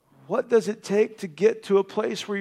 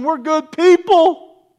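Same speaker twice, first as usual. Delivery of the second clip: higher, louder, slow mock boast; proud mock claim; 7 semitones higher, 7 dB louder, slower than usual. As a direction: swell it into a smug boast. Climax of mock quote.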